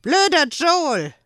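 high, slow loud sulky insult; boy hurling an insult in a huff; offended child. A narrator's reading. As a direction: pout and yell it.

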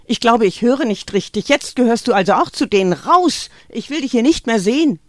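loud, high, fast furious yelling; shouting. A narrator's reading. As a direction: throw her out with a shout. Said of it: "schrie Greta".

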